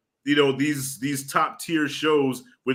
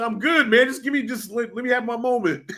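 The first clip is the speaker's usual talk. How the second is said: higher, pleading, half laughing; mock self-pity; 8 semitones higher than usual. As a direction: beg for a moment, hamming it up. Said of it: "I said".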